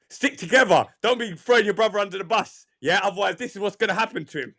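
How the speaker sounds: lightly voiced stern father. Father teaching his sons a lesson, mock scolding. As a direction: lay down the rule like a scolding dad.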